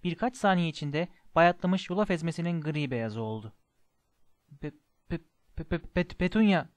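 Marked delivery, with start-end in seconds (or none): 4.6-6.6 halting, stunned stutter; shocked stammer. A narrator's reading. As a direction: stammer on the first syllable until the name comes out. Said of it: "diye kekeledi".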